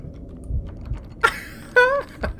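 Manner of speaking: very high, panicky giggle; helpless laugh after a fright; nervous squealing laugh. Real, not acted. Nervous reaction after scare.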